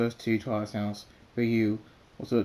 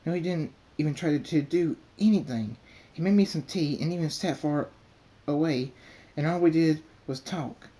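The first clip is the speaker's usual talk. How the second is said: a touch higher and faster pleading; mildly heated protest; 6 semitones higher, 4 dB louder than usual. A narrator's reading. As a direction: argue quickly to defend him. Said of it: "Twilight says".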